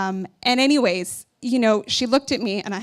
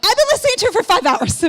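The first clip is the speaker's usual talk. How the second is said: higher, louder and fast, fed-up retort; exasperated outburst; 12 semitones higher, 7 dB louder, faster than usual. Retold or imitated. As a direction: blurt it out, frazzled and at wits' end. Retold exasperated reply in emergency.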